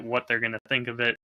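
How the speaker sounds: normal talking voice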